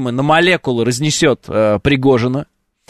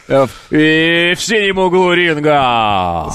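slow, drawn-out fight intro; boxing ring announcer; much slower than usual. Pretend. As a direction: announce the fighter in the blue corner. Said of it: "ты как этот, как будто в М М А". (медленно, как ринг-анонсер)

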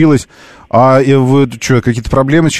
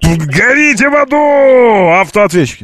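furious shout; 10 semitones higher, much slower than usual. Real, not acted. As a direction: blow up at the answering machine. Ведущий взрывается на автоответчик. (яростный крик)

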